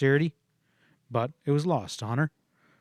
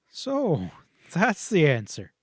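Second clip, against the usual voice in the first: teasing; 4 semitones higher, slower than usual. A narrator's reading. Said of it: "he twitted".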